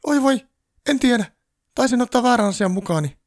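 very high, loud panicked squeal; a frantic little elf squealing. A narrator's reading. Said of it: "juoksi kiljuen".

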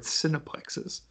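whispered, savoring cineplexes; savoring a word. Shown as a demonstration. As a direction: breathe cineplexes out softly. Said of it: "Ooh, that's a fun word".

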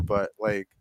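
ordinary talk

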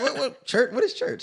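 high, laughing disbelief; baffled surprise. Retold or imitated. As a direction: act totally baffled. Mock confusion about going to church.

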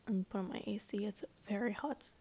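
soft whispered aside. Real, not acted. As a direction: breathe it under the breath. Aside about the A C.

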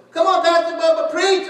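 high, loud call of encouragement; Miss Jean calling out. Retold or imitated. Church lady urges the pastor on, high loud call. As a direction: shout it from the pews. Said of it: "she'll go".

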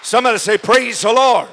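loud, high preacher's cry; rousing shout. Real, not acted. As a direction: call the whole congregation to praise.

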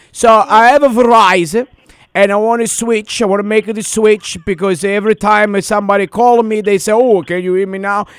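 put-on foreign caller accent; broken English accent.

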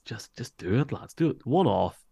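rising, eager urging of the league; egging them on, pitch climbing; a fan's excited rallying cry; excited plea. Real, not acted. Pleading for an Irish game.

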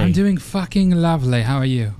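hammed-up fake British cheerio; terrible put-on British accent; bad London accent. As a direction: answer in a badly faked London accent. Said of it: "those are terrible accents".

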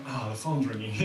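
low, quiet weary grumble; reluctant sigh. Acted. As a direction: dread the call, voice dropping low and soft. Groans at a phone call now.

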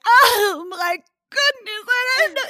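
loud, high, hammed-up wail; soap-opera fake crying. Mother fake crying to satisfy daughter, hammed-up wail. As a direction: badly fake a melodramatic sob, drawn out and shouted. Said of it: "my best soap opera acting, which was not very good".

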